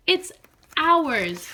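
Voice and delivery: cheerful frog moral; happy sharing. Acted. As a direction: land the lesson happily.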